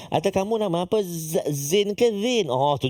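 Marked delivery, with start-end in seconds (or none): none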